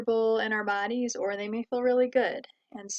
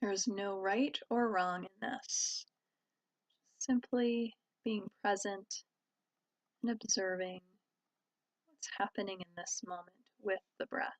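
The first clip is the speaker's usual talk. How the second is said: calm, even voice with long pauses; guided meditation. Real, not acted. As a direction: guide listeners gently inward, leaving space between phrases. Leads a meditation.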